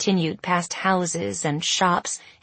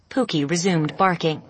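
ordinary delivery

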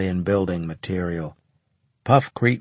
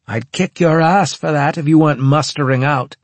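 raised, higher voice thrown after someone leaving; calling after someone; 7 semitones higher, 6 dB louder than usual. A narrator's reading. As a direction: call it out loudly at their back. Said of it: "he called after me".

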